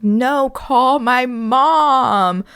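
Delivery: put-on high, drawn-out kid's wail, not full volume; hammed-up slow cry; mock screaming. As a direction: imitate a hurt kid pleading, words stretched out. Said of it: "screaming bloody murder".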